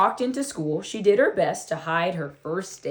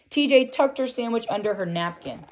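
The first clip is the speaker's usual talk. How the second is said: ordinary delivery, nothing marked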